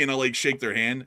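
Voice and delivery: ordinary delivery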